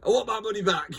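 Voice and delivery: high, loud, hammed-up complaint; mock indignant demand. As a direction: demand the refund like an outraged customer. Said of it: "he went".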